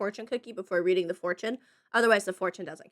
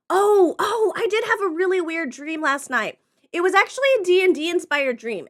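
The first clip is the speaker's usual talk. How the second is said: excited; 8 semitones higher, 7 dB louder than usual. Real, not acted. Sudden memory of dream.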